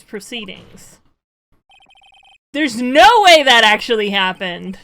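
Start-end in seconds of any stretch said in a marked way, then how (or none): 2.5-4.8 shouted in own voice without the accent; loud, no accent. Shown as a demonstration. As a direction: yell it plainly, no accent. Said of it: "I didn't do the accent".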